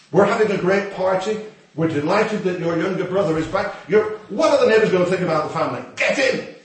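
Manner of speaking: a father hissing at his son to come inside; scolding father. Retold or imitated.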